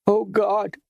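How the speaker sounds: high anguished cry; sobbing. A narrator's reading. Esmeralda sobs as her anger collapses into anguish. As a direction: let grief break in. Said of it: "she sobbed".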